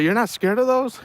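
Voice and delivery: can't believe dad isn't scared; incredulous question. Retold or imitated. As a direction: son amazed at his fearless dad. Kid asking dad after the toss.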